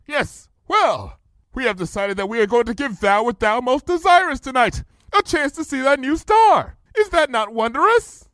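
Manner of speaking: high lofty royal voice, proud; pompous royal announcement; regal princess. A narrator's reading. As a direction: play the grand princess bestowing a gift. Luna's royal voice.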